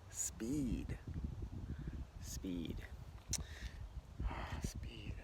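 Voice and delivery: breathy murmur, mulling it over; whisper. Shown as a demonstration. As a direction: whisper the word to yourself. Musing over the card, whispering.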